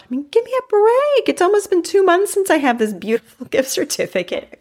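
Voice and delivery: very high-pitched, incredulous self-scolding; mock exasperation. Real, not acted. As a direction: squeal it in playful exasperation at yourself. Mock scolding herself over unused certificate.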